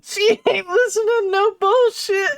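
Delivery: high, loud laughing voice. Real, not acted. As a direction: speak through helpless laughter. Laughing at the drawing.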